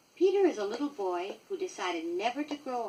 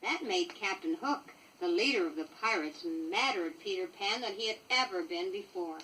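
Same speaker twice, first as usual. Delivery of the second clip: ordinary delivery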